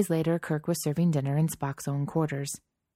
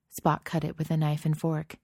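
usual speaking voice

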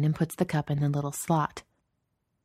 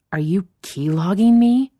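loud, higher incredulous question; indignant disbelief; 4 semitones higher, 8 dB louder than usual. Acted. Employee suspects his boss is spying, indignant disbelief. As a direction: accuse with rising, louder disbelief.

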